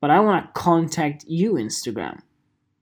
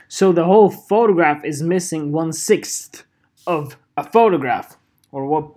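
normal talking voice

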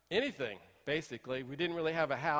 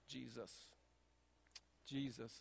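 near-silent pleading Jesus, much softer; desperate murmur; 4 semitones lower, 16 dB quieter, slower than usual. Retold or imitated. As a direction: breathe the name out, worn out and desperate. Hiding desperate in the closet.

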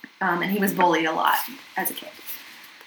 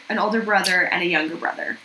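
ordinary talk, nothing marked

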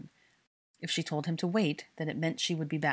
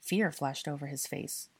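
usual speaking voice

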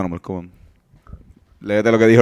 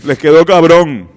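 higher, louder, slow announcer read; 4 semitones higher, 7 dB louder than usual. Shown as a demonstration. Reading another comment in a higher, louder announcer voice. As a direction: boom it out like a radio host. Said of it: "ponte una voz de locutor".

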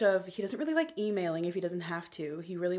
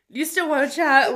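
mock cheerful nudge; 7 semitones higher, 11 dB louder than usual. Retelling own nudging message.

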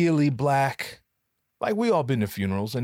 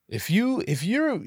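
normal talking voice

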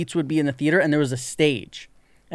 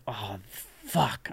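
much softer, sinking curse under the breath; dismayed mutter; 6 semitones lower, 11 dB quieter than usual. Retold or imitated. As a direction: swear quietly as the trouble sinks in. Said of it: "And I'm like".

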